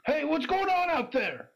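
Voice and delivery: high, loud startled call; alarmed shout. Acted. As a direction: yell out from behind a door. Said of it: "some voices from inside say".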